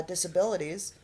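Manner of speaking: ordinary talk, nothing marked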